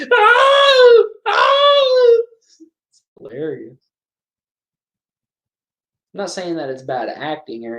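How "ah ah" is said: very high, loud mock shriek; mock scream. Vlogger imitates the screaming girl, very high mock shriek. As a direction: shriek twice like a horror actress. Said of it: "She's like".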